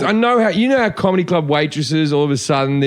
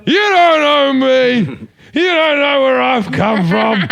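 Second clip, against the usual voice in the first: put-on much higher, louder, very slow drunken outburst; hammed-up wounded drunk yelling; shouting back at the guy at the table, hammed-up; mock indignant shout; 9 semitones higher, 5 dB louder, much slower than usual. Retold drunk retort to bar patron.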